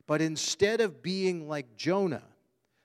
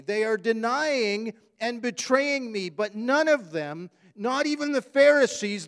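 louder and higher, driving fast; full-force preaching; 8 semitones higher, 5 dB louder than usual. Acted. Pastor urging the church about forgiveness, fervent and loud. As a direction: hammer it home to the congregation.

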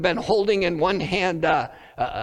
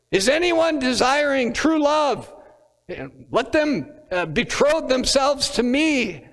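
raised, grand mock proclamation; wedding interrupter; 7 semitones higher than usual. A stranger interrupting a wedding, hammed-up proclamation. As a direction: play the odd guest hamming it up. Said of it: "someone stands up in the audience and says".

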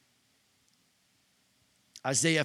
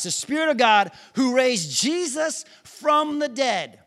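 higher, louder, excited proclamation; excited preaching; 7 semitones higher, 4 dB louder than usual. Real, not acted. Preacher excited about scripture, proclaiming loudly. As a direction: ride the joy of the good news.

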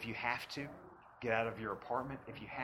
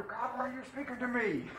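laughing mock plea; 11 semitones higher, 3 dB louder than usual. Voices believer, laughing.

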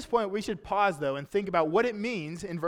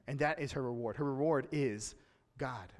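softer, lower, dropping to God; quiet reverent landing on God; hushed emphasis; 4 semitones lower, 6 dB quieter than usual. Acted. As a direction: let it sink in quietly. Sermon climax on reward.

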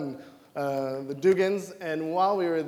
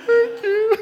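much higher, louder laughing thank you; 8 dB louder than usual. Retold or imitated. Preacher thanking a friend for his wise words, laughing. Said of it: "And I was like".

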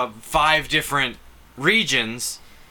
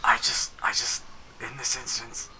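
secretive hushed whisper; worried whisper; 4 semitones lower, 6 dB quieter than usual. Pretend. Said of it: "So that Lewis can't hear".

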